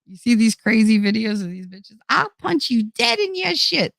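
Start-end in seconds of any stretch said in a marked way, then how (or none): 2.1-3.9 put-on much higher, playful tough-girl threat; hammed-up Jersey threat; mock tough threat. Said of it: "That's what I say".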